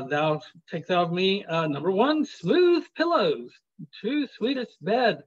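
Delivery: lilting verse reading; poem recitation. Acted. Reads sonnet list aloud.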